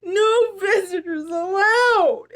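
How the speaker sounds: slow, high, loud mock sob; hammed-up weepy bear; sniffling. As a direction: blubber the rule out. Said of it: "he sniffled".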